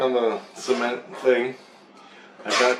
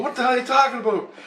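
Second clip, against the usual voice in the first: fast, high-pitched incredulous question; retold bafflement; 13 semitones higher, faster than usual. Dreamer answering talk of the illusion, quick high incredulous retort. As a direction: fire it back baffled, voice pitched up.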